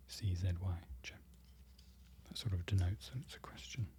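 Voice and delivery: soft explanatory whisper, barely voiced; whispered grammar note; ASMR whisper. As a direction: explain softly in a breathy hush.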